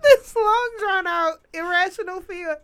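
high voice cracking with laughter; laughing. Real, not acted. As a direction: talk through helpless laughter.

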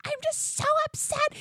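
very high imitated wail; upset, angry crying. Pregnant wife melting down to her husband, mock angry wail. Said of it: "then I started getting angry too".

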